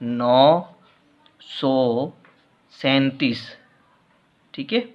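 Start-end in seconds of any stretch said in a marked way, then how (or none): 0.0-3.5 slow dictation. Shown as a demonstration. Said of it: "इसे शब्दों में लिखेंगे".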